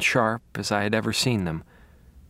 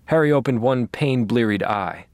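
plain reading voice

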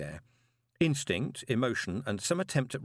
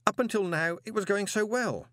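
high-pitched strained complaint; 10 semitones higher than usual. A narrator's reading. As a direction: voice jumps up high, pained.

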